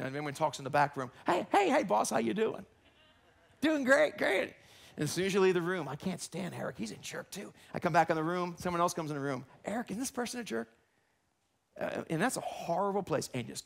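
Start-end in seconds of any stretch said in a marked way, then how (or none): 1.2-4.5 fake cheerful greeting. Fake friendly greeting to boss. 5.9-7.5 dish the dirt quietly out of earshot; gossiping aside. Acted. Employee griping about the boss behind his back, hushed and soft. 9.6-10.7 hushed gossip. Acted. Gossip with coworker.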